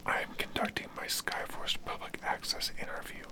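ordinary talk, nothing marked

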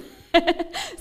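loud, high burst of laughter; real, hearty laugh; amused laugh. Real, not acted. Laughs at her own requests.

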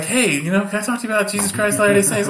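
high, fast chirpy pitch; mock missionary. Missionary interrupting an argument, high and fast. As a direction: rattle it off like a doorstep missionary. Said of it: "someone's like".

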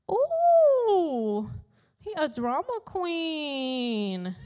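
high, sassy "ooh" that drops into a slow, drawn-out jab; a teasing, drawn-out dig; sassy aside. Real, not acted. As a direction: throw shade at Jonah, slowly. Sassy aside about Jonah.

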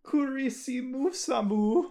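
Japanese word with an accidental German flair; odd put-on accent. Real, not acted. Reading out the Japanese word for Christmas, botched accent with German flair. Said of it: "what was that accent?".

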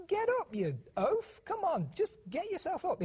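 mock scolding. Father mocking how not to scold a fallen child, hammed-up high scold. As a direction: bark it at a clumsy kid, in jest.

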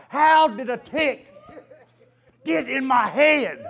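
slow, loud disbelief; slow, loud laughing wonder; amazed question. Real, not acted. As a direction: drag out the disbelief.

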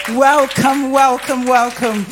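loud, hearty repeated welcome; big, rallying church greeting; rousing welcome. Real, not acted. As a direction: call it out loudly to the newcomers.

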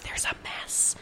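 hushed whisper. Retold or imitated. Another visitor reports the mess, quiet whisper. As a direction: breathe it discreetly. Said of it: "being like".

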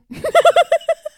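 loud high cackle; burst of laughter. Real, not acted. Laughing out loud at her [name] fracking joke. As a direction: crack up at her own joke.

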